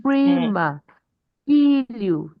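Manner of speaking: high, stretched first syllables in 'Prima', 'Filho'; stress on first syllable. Shown as a demonstration. Teacher modelling Portuguese words, first syllables of 'Prima', 'Filho' stressed. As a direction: hit PRI and FI, then drop. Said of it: "that's how to pronounce Portuguese words".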